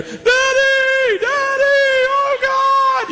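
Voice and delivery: imitated high, wailing call to God; crying out. Stoic guys suddenly overwhelmed by God, mock high crying out.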